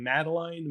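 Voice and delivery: Madeline ending like "line"; name pronunciation guess. Shown as a demonstration. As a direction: say Madeline to rhyme the last syllable with "nine". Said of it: "what's her name".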